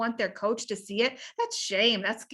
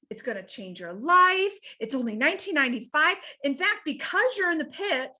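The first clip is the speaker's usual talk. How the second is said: higher salesman pitch, fast at the price; 4 semitones higher than usual. Retold or imitated. Salesman sells to the man in the pit, higher. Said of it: "happened to be a salesman".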